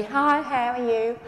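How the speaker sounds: slow, routine greeting with no spark; unexcited, drawn-out small talk; humdrum greeting. Acted. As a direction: mimic a dull, habitual hello. Greeting she tells them to drop.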